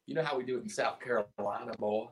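put-on lightly drawled Southern accent. Bandmate shows how they talk down South, mild Southern accent. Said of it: "Southern accent".

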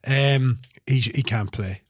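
normal talking voice

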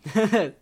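sudden high burst of laughter; nervous laugh. Real, not acted. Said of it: "people are not gonna like me".

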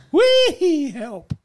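high, loud "wee" then a smaller cry for help; comic high-pitched yell, dropping off on help; mock yell. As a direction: squeal like a kid on a runaway pony.

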